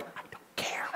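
very soft, voiceless retort; mock seething whisper. Husband brushing off wife's excuses, acted seething whisper. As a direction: whisper it coldly, playing the grump. Retold fed-up reply to wife.

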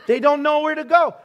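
loud, high-pitched complaint; comic exasperation to the audience; exasperated outburst. Real, not acted. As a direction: throw your voice up in playful despair. Mock exasperation after retold food argument.

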